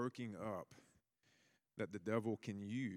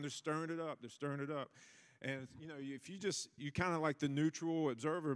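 usual speaking voice

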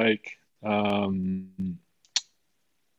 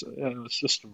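usual speaking voice

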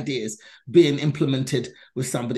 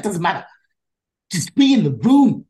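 much higher and louder telling-off; scolding; 4 semitones higher, 7 dB louder than usual. Retold or imitated. The brother scolds her for turning the job down, loudly. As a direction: bark the advice at her.

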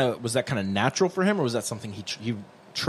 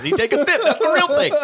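words squeezed out through laughter; cracking up mid-sentence; laughing; 4 dB louder than usual. Real, not acted. Laughing hard while talking.

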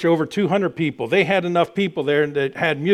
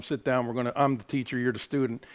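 softer, imitating a stern teacher; hushed teacher voice; 6 semitones lower, 10 dB quieter than usual. Teacher telling the boy to sit down, mock hushed. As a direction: murmur the teacher's order under your breath.

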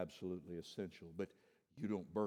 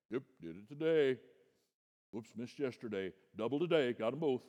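mock self-satisfied Bible reader, a bit louder; smug box-ticking; 4 semitones higher, 8 dB louder than usual. Preacher mocking the churchgoer who ticks off reading plans, smugly. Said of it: "so you can check off on your list".

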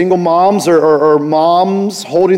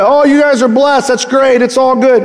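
breezy, higher, louder offhand brush-off; dismissive brush-off; 7 semitones higher, 4 dB louder than usual. Retold or imitated. Pastor mimics a dismissive blessing of the kids, breezy and offhand. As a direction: toss it away like it doesn't matter. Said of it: "He didn't just dismiss".

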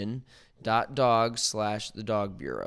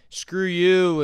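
mock insult; 7 semitones higher, 7 dB louder than usual. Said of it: "you're gonna get bashed".

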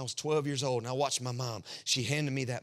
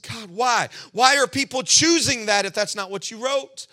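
higher, louder anguished questioning; crying out why to God; pleading outcry; 5 semitones higher, 6 dB louder than usual. Retold or imitated. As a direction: demand answers from God. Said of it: "I said".

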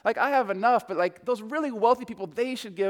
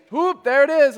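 Joseph's call; 7 semitones higher, 9 dB louder than usual. Retold or imitated. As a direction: belt it out like the old man on the block. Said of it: "Bangs on the door".

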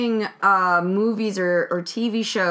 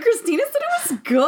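playful high voice; 12 semitones higher than usual. Acted. Showing off the voice.

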